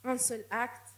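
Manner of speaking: ordinary talk, nothing marked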